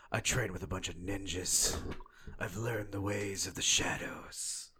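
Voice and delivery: hushed, whispery Batman. Acted. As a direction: whisper it slowly like a brooding Batman. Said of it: "how to disguise my voice".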